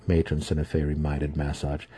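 ordinary delivery

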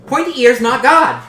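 high, loud goblin shout; shouted goblin retort. Acted. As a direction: yell it back stubbornly.

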